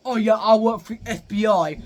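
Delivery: hammed-up irritating woman; annoying sister. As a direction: imitate an obnoxious, loud know-it-all sister. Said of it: "She is the most annoying thing ever".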